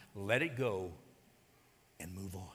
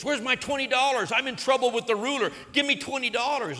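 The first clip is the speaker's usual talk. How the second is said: higher, louder mock-angry demand; 8 semitones higher, 6 dB louder than usual. Servant demanding his twenty dollars from buddy, angry. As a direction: bark it at the debtor, acted anger. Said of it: "he gets angry and says".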